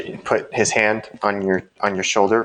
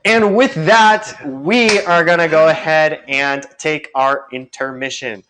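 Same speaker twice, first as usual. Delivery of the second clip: drawn-out, louder show-host announcement; announcer sign-off; 7 semitones higher, 3 dB louder than usual. Real, not acted. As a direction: announce the break, starting high and slow.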